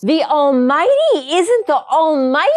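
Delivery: high, loud incredulous outburst; over-dramatic, high-pitched shock; dramatic disbelief. Real, not acted. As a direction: cry out in scandalised disbelief. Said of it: "I'm just being dramatic".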